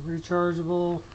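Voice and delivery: normal talking voice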